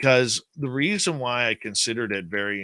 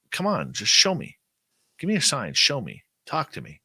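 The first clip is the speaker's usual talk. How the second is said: taunting; 6 semitones higher, 3 dB louder than usual. Retold or imitated. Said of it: "And I was taunting it".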